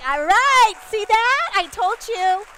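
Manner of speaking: loud, high told-you-so; triumphant gloat. Acted. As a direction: crow about it to the manager. DJ gloating after crowd cheers.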